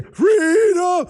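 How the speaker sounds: high, loud movie war cry; Mel Gibson battle cry. Retold or imitated. Quoting the Braveheart hero's cry, high loud shout. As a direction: belt it like a hero charging into battle. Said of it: "when Mel Gibson says".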